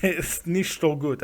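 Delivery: mock German with a Dracula accent; Transylvanian Dracula accent. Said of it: "said it with a Transylvanian Dracula accent".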